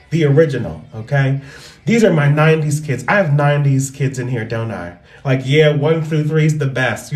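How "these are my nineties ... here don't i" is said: ordinary talk, nothing marked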